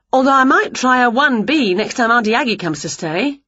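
lively high witch voice, quick; eager little witch; a bright excited witch; Winnie voice. Acted. Winnie continues.

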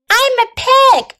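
put-on high, excited boy's voice; Avery crying out. A narrator's reading. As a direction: pitch it way up like a gleeful child. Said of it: "cried Avery".

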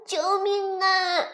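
high, slow, loud call for help; cry for help. Acted. Falling dinosaur calling for rescue, high drawn-out shout. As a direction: wail it out across the valley. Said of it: "尼大叫道".

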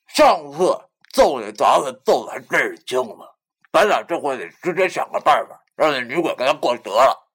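high, loud, indignant character voice; huffy. A narrator's reading. Friend plotting payback after spitting out a bone, huffy. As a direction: sputter agreement, then scheme in a huff. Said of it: "气嘟嘟地说".

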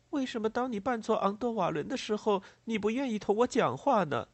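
put-on high-pitched child asking a puzzled question; young child. A narrator's reading. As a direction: plead as a little child wanting an answer. Child character questions Lémor. (孩子般高音的疑问)

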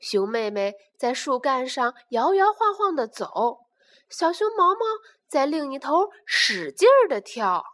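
usual speaking voice

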